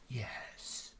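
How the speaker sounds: a breathy, whispered air-punch yes; quietly gloating whispered yes; mock triumphant yes. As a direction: hiss a private victory yes, like Powell leaving the stage. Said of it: "He went".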